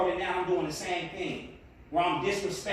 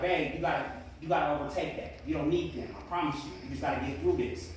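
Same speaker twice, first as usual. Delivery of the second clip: reassuring wife; 6 semitones lower, 4 dB quieter than usual. Retold or imitated. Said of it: "I was like".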